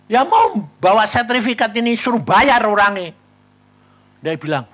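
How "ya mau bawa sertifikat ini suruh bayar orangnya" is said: higher, louder, eager reply; excited insistence. Retold or imitated. Speaker telling friend his plan, eager and loud.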